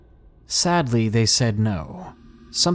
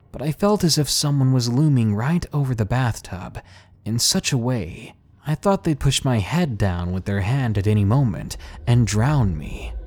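plain reading voice

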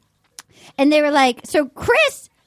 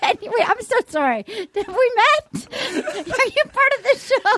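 higher-pitched pretend apology; mock polite confusion; 7 semitones higher than usual. Castmates asking if he's on the show, mock polite confusion. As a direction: query a stranger in a higher put-on voice. Acting out castmates not recognising him.

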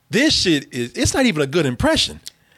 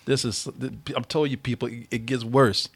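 mock doom; 9 semitones lower, 7 dB quieter than usual. Dramatic doom announcement to audience.

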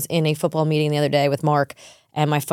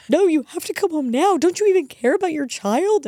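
put-on high frantic child pleading; panicked kid voice; 11 semitones higher than usual. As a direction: voice a scared little boy. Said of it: "And he's like".

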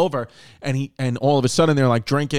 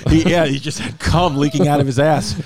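laughing; 2 semitones higher than usual. Real, not acted.

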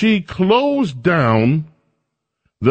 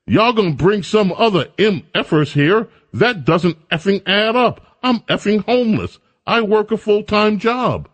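imitating angry resident; 7 semitones higher than usual. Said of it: "he said".

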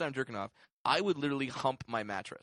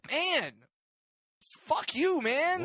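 mock outrage; 13 semitones higher, 3 dB louder, much slower than usual. Real, not acted. Outrage at hairy ass joke.

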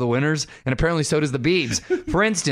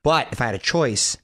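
normal talking voice

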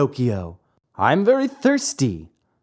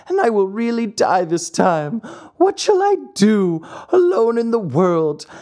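high, wailing puppet's grief; sobbing lament; 7 semitones higher than usual. A narrator's reading. Pinocchio mourning his dead fairy alone, sobbing lament. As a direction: plead and wail like a heartbroken child. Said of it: "As he sobbed, he said to himself".

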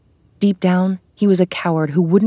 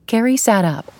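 usual speaking voice

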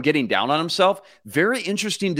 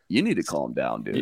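much lower, softer calm-down voice; deep coach voice; 14 semitones lower, 6 dB quieter than usual. Retold or imitated. Coach telling his quarterback to calm down, low and soft. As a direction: talk him down low and flat. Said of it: "K O C just goes".

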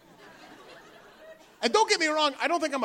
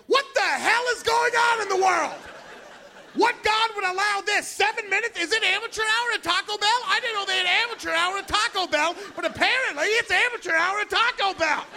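retold angry rant; 9 semitones higher than usual. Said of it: "I was in the car behind them like".

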